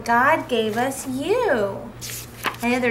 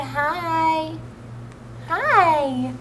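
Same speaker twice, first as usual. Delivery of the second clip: put-on baby voice; 6 semitones higher than usual. Voicing baby greeting.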